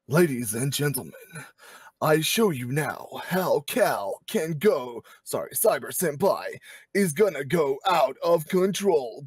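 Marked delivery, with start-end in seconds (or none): 0.1-5.0 slow, grand announcer voice; dramatic voice. Pretend. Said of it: "I'm gonna have to do this in a dramatic voice". 6.9-9.2 dramatic voice. Pretend. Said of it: "in a dramatic voice".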